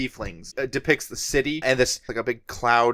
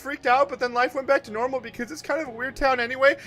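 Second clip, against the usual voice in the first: high, chatty creature; high rambling creature voice; 11 semitones higher than usual. Acted.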